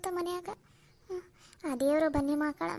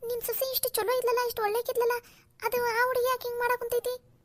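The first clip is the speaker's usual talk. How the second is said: surprised disbelief; 8 semitones higher than usual. Acted. Shocked neighbour hears complaint.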